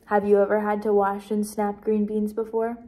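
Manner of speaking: ordinary delivery, nothing marked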